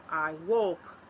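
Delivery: "I walk" with the weight on "walk"; light "I", stronger "walk"; stress on WALK. Shown as a demonstration. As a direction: lean on "walk" in "I walk". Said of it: "and walk is the iamb".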